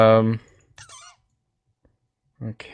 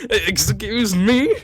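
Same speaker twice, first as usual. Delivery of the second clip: laughing mock offence; 8 semitones higher, much slower than usual. Real, not acted.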